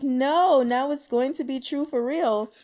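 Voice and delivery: high, loud, breathless no; an eager, loud fangirl outburst; excited protest. Retold or imitated. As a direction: blurt it out like a fan proved right. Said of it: "I was like".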